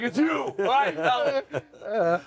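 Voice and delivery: high exasperated outburst; mock exasperation with a laugh; fed up. Jesus snaps at Judas over dinner, comically fed up. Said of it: "he was so fed up".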